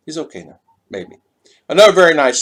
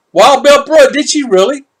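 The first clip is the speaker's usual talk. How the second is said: high, loud astonished wow; surprised; 13 semitones higher, 9 dB louder than usual. Real, not acted. Vlogger reacting to viewer's story, loud amazed wow. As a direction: gasp at the news, loud and high.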